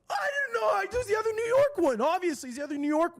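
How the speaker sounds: high, loud sarcastic yell; exasperated outburst. Real, not acted. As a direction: blow up in mock outrage, then rush on. Outburst over the wrong flight.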